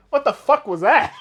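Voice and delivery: loud, high, laughing outburst; laughing disbelief. Retold or imitated. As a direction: blurt it out incredulous through laughter. Retelling baffled reaction, cracking up.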